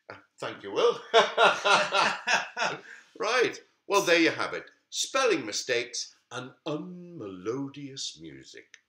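plain reading voice

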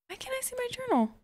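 real exasperation; exasperated high whine. Real, not acted. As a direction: whine it in disbelief. Frustrated at missing journal.

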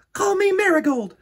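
put-on high, loud pleading girl; eager girl. A narrator's reading. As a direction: beg to be a flower too. Jo now envious.

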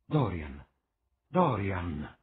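anxious exclamation; urgent call. A narrator's reading. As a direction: call his name to stop him. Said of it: "esclamò".

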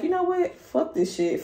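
very high, sudden and quick; quick fed-up snap. Pretend. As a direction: fire it off. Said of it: "woke up one day and like".

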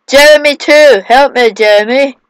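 mock desperate call. Player calling Jeremy for help, mock desperation. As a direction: yell to a companion in play panic. Calls game character.

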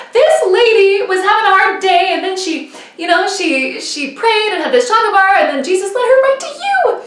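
high, loud, gushing; excited retelling. Retold or imitated. Teen girl telling her friend the whole story, high and excited.

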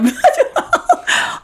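very high, loud wail; uncontrollable wail. Retold or imitated. Actress losing her mind during the reading, uncontrollable wail. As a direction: let out a loud wail you can't hold back. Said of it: "I can't control myself".

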